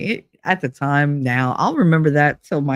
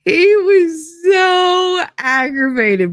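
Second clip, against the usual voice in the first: laughing; 13 semitones higher, much slower than usual. Real, not acted. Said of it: "laughing while telling".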